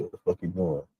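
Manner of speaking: usual speaking voice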